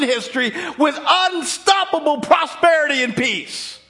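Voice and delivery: high, loud, shouted sermon; fiery preacher calling out the crowd; full-force preaching. Acted. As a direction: thunder it at the congregation. Preaches Peter's charge at full force.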